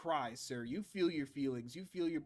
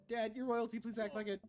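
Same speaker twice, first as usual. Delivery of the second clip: higher, bratty little princess; higher child voice; 8 semitones higher than usual. Shown as a demonstration. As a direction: pitch it up like a kid.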